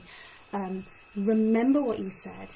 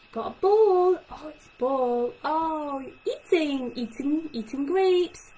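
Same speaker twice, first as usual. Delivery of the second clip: high, loud, slow, simple words with a gasp, eating grapes; excited talk to a toddler; 6 semitones higher, 5 dB louder, slower than usual. Shown as a demonstration. Speech therapist modelling short sentences for a child, excited. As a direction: delight a toddler with single words. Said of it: "so things like".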